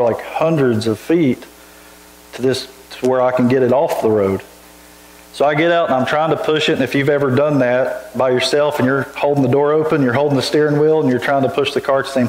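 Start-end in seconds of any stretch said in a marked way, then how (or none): none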